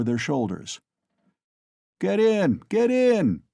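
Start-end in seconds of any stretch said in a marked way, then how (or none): 2.0-3.4 put-on loud drunken peasant shout. A narrator's reading. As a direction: bellow it across the street. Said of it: "shouted one of them".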